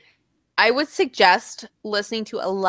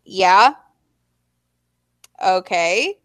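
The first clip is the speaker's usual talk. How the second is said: held-in anger; 3 semitones lower, 3 dB louder, much slower than usual. Retold or imitated. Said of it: "doesn't want to just explode".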